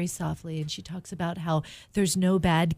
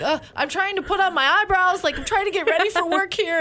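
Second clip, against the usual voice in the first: put-on busy mom brushing off her kid; exasperated mom; 11 semitones higher than usual. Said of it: "And I'm like".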